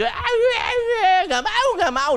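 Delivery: put-on very high, loud child's tantrum wail; mock crying. Dad imitating his daughter refusing punishment, mock crying.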